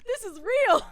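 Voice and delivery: laughing through the words, high and loud; laughing so hard. Real, not acted. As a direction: crack up mid-sentence, almost crying. Laughing fit after accent joke.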